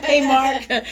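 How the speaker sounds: amused shout-out to a regular; laughing. Real, not acted. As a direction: greet him through a laugh. Greets friend with laugh.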